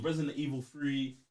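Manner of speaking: normal talking voice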